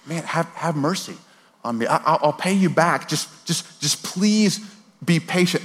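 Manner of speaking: acted stammering plea for mercy; pleading servant. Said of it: "fellow servant, his peer says".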